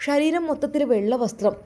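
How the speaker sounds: usual speaking voice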